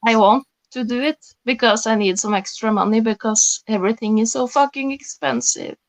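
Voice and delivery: normal talking voice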